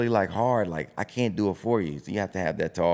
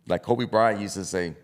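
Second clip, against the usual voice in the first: ordinary talk, nothing marked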